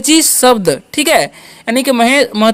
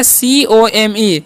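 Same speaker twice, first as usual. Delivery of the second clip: slow letter by letter spelling, C O M E; reading the letters aloud slowly to the class; much slower than usual. Shown as a demonstration. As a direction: spell out the letters of "सीओएमई" one at a time. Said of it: "क्या लिखा हुआ है?".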